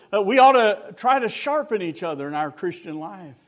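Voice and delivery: loud, high preaching exhortation; fervent preaching. Acted. Preacher quoting Proverbs to the congregation, loud and fervent. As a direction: hammer the scripture home to the church.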